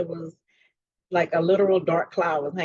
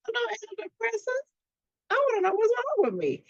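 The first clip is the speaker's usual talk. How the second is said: indignant protest. Retold or imitated. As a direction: voice jumps way up, outraged at the suggestion. Retold protest to her doctor.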